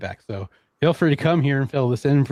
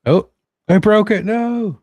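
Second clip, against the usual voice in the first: startled dismay; 9 semitones higher, 7 dB louder, slower than usual. Real, not acted. Live demo suddenly fails.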